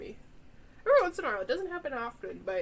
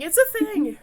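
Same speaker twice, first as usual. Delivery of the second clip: louder, higher, insisting hard; emphatic insistence; 9 semitones higher, 9 dB louder than usual. Real, not acted. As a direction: hammer the point home. Insisting a point to doubters.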